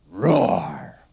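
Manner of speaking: short imitated lion roar. Shown as a demonstration. Teacher finishing the word lion, short roar. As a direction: give a brief roar like a lion. Lion noise after spelling lion.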